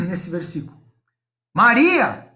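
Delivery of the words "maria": "Maria" called out louder, as a summons; preacher voicing Jesus calling "Maria!" aloud. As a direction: call "Maria!" across to her. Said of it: "Exclamação. É Jesus falando alto".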